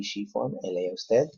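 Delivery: normal talking voice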